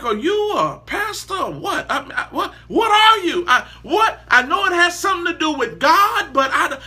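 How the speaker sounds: high, puzzled stammering questions; mock confused what are you; bewildered outsider. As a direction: play someone baffled and amazed. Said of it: "They like".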